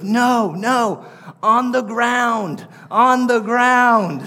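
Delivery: put-on high, slow, drawn-out little-kid wail; toddler son protesting. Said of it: "he goes".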